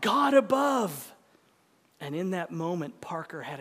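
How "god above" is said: drawn-out, higher narrated cry; yelling out. A narrator's reading. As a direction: call it out slowly like a man being thrown. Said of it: "he yells out".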